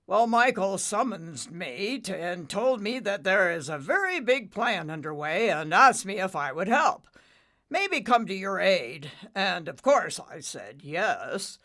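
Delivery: lightly voiced old storm father; father cloud.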